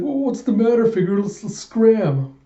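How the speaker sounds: high-pitched jittery little guy; high nervous sidekick. Acted. Small sidekick urging escape.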